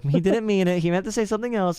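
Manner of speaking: high, loud protest; mock apologist. Defenders excusing Trump, mocking high-pitched protest. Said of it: "people probably be like".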